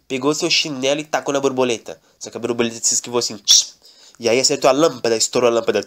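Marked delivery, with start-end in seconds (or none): none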